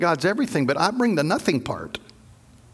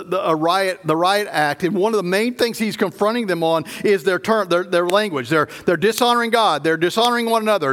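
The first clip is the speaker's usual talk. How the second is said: ordinary delivery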